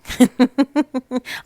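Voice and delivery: loud, high self-mocking laugh; a real, bright laugh at herself; burst of laughter. Real, not acted. Laughs after admitting overwhelm.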